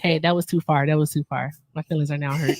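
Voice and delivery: softly fake-wounded, half-joking; mock hurt. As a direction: play the hurt feelings, then let it go. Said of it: "Just messing".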